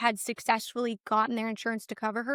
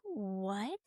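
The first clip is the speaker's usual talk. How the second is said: flabbergasted; 4 semitones lower, 12 dB quieter than usual. Retold or imitated.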